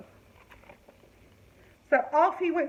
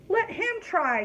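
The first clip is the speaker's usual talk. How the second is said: commanding princess; 7 semitones higher than usual. Acted. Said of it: "commanded the princess".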